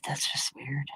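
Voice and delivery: near-whispered aside; hushed retold reaction. Retold own stunned reaction.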